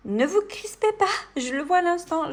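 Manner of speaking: high, loud playful plea; head off their objections in a playful high cry; mock-alarmed reassurance. Vlogger stops viewers fretting over unfinished gloves, mock-alarmed and high.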